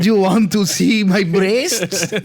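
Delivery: slow, high Russian spy voice breaking into laughter; laughing Russian accent parody; mock Russian accent, laughing. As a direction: ham up the seductive spy. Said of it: "Jennifer Lawrence going".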